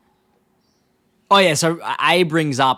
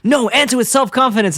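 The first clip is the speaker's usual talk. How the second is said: high, louder mock command; emphatic order; 11 semitones higher, 5 dB louder than usual. Herb giving Homer a pep talk, acted emphatic demand. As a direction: imitate an insistent coach demanding more. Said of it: "he says something really, uh, em-, emphatic".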